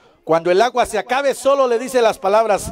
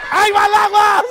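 a loud, much higher cry of triumph; excited shout; 10 semitones higher, 5 dB louder than usual. Acted. Clown pours the magic water for the kids, shouting. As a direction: shout it out as the trick pays off.

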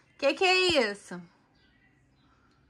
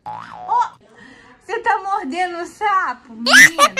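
amused surprise; 6 semitones higher, 5 dB louder than usual. Real, not acted. Child biting the toy frog.

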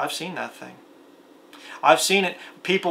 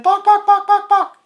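high, loud mock chicken clucks; chicken clucking; 10 dB louder, slower than usual. A bully taunting the coward, clucking high and loud. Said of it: "You chicken".

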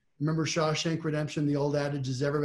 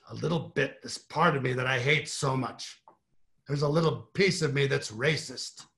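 hint of Jerry Spence confessing to the jury; Jerry Spence impression. Lawyer confessing to the jury, lightly voiced Jerry Spence. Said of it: "I'll do my Jerry Spence impression".

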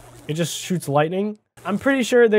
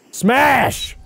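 shouted smash; 8 semitones higher than usual. Pretend. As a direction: yell it like a wrestler's slam. Voicing buddy smashing in game.

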